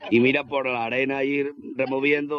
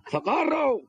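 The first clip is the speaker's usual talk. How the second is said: very high comic shout for help; hammed-up yelp from down the hole; mock cry for help; 12 semitones higher, much slower than usual. Fallen worker calling for help. (grito cómico muy agudo pidiendo socorro)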